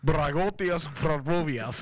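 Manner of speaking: slow fake Russian accent; mock Russian accent gibberish. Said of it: "do this Mad Lib in a Russian accent".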